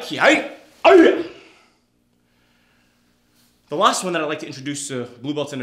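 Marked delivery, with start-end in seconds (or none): 0.0-1.2 very loud yell jumping high; explosive karate kiai; kiai shout. Real, not acted. Instructor ending collarbone strike drill, kiai shout.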